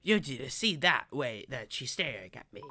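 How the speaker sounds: put-on low-pitched kid monster character. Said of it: "need to have a different accent for this character".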